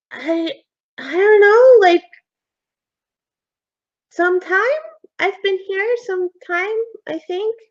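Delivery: put-on high, hesitant child's voice; unsure ghost boy. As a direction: think it over like a small kid.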